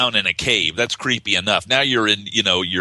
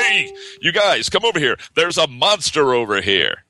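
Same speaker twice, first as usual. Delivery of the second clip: stilted dub voice; 6 semitones higher than usual. Retold or imitated. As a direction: overact it like a clunky dubbed hero. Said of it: "who the hell talks like that".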